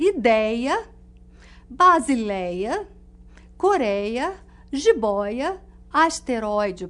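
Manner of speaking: slow word list, each open diphthong in "ideia" and "jiboia" punched; open diphthong examples. Shown as a demonstration. Teacher listing open-diphthong examples, slow and emphatic. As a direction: stretch the open "ei" in "ideia", "basileia", "coreia", the open "oi" in "jiboia", "asteroide". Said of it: "Um exemplo disso é".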